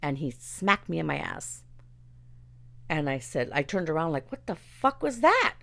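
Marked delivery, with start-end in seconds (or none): 4.3-5.6 higher and louder startled retort; reenacted indignant yell; shocked outburst. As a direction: spin around and snap back in shock. After being smacked, retold reaction.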